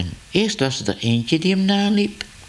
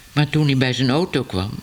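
ordinary delivery, nothing marked

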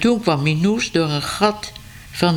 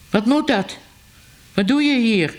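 put-on higher, sharp angry cry at the girl; hot-tempered shout; 6 semitones higher than usual. A narrator's reading. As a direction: snap at the intruder, raised pitch. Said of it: "riep meneer Ellemeet driftig". (driftig uitgeroepen, hoger)

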